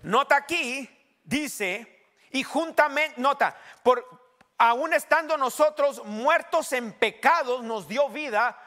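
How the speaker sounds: high, loud, emphatic scripture reading; forceful preacher driving each phrase home; full-force preaching. Real, not acted. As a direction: proclaim the verse, stretching the key words.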